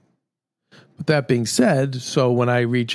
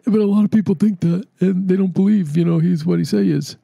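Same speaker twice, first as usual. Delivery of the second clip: raised-pitch impression of a friend; imitating his talk; 7 semitones higher than usual. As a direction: keep his higher voice going. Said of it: "he talks like that".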